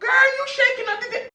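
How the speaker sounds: startled by the spill. Real, not acted. Water spilled.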